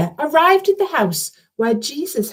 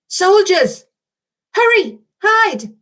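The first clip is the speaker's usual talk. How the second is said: much higher, louder urgent warning; frightened cry; 10 semitones higher, 4 dB louder, slower than usual. Acted. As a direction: Alia warns friends of soldiers, alarmed. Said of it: "cried Alia".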